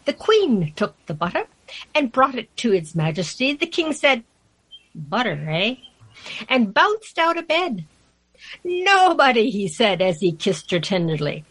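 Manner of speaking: measured, lilting storybook verse; poem recitation. Acted. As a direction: read the rhyme theatrically, giving each character a voice. Reads a poem aloud.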